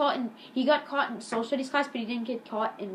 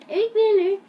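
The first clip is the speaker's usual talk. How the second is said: much higher, louder playful echo; 11 semitones higher, 9 dB louder than usual. Pretend. Vlogger echoes the title name for the intro, high playful echo.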